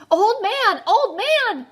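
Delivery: high, loud urgent call; shouting. A narrator's reading. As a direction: call out to rouse the old man. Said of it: "shouted Chang".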